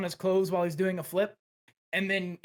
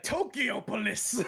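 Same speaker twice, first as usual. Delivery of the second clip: slow awed Greek hero; 4 semitones higher, slower than usual. Retold or imitated. Demetrius repeating, awed.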